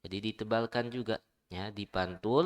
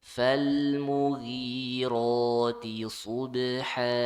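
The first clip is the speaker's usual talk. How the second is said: Quran recitation. Shown as a demonstration. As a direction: recite it like a teacher modelling tajwid. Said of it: "Coba ulang".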